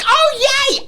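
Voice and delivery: very high imitated cheer; high-pitched, hammed-up glee; mock delight. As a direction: squeal it like a thrilled fan. Imitates woman delighted to see Luke.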